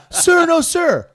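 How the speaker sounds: high, loud shouted reply; recruit shouting. Retold or imitated. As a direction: yell it like a scared recruit.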